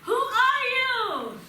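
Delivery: high, loud, drawn-out call; screamed question. Acted. Curious boy to the unknown voice, screamed question. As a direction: call it out slowly to the mountain. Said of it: "he screams".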